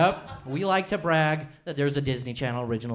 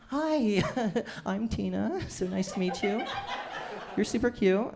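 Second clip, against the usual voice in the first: put-on lightly higher, sweet girlfriend greeting; 7 semitones higher than usual. Girlfriend greeting boyfriend's mom, lightly high and sweet. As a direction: play the girlfriend meeting his mom, sweetly.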